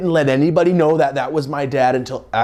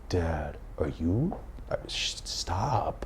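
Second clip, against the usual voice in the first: slow, soft whispered plea to stop; 6 semitones lower, 13 dB quieter, much slower than usual. Retold or imitated. Embarrassed son to his dad on the sideline, a slow whispered plea. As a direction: beg him quietly to stop.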